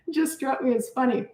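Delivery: ordinary delivery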